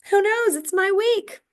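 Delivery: very high, gleeful lilt; giddy, carefree. Real, not acted. Teacher celebrating her free spring break week, high and giddy. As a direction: leap way up in pitch with glee.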